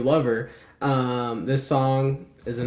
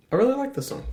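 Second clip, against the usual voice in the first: chewing ice while talking; words round a mouthful of ice; 13 semitones higher, much faster than usual. Real, not acted. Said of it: "Sorry, I'm chewing ice".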